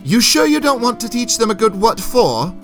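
high-pitched, teasing knight's question; wry. A narrator's reading. Knight inviting old soldier to spar with squires, wry. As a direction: coax a comrade with a wry challenge. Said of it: "Sir Lancel asked wryly".

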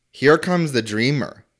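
ordinary talk, nothing marked

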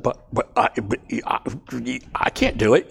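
halting stammered protest; a mild stammering refusal; stuttering. Retold or imitated. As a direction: trip over the words like Moses. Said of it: "He stuttered".

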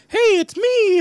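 high, loud squeaky cartoon mouse; Mickey Mouse voice. Sister bursts into Mickey Mouse during guessing game, high squeaky cartoon voice. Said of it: "bust into a Mickey Mouse voice".

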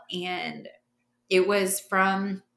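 ordinary delivery

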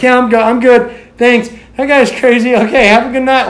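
put-on embarrassed boy insisting he's fine; retold brush-off. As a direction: wave her away, faking fine. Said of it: "I was like".

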